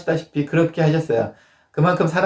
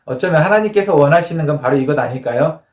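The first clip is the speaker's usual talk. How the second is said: ordinary talk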